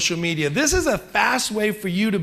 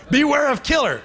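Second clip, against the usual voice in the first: laughing mock warning; 7 semitones higher, 4 dB louder than usual.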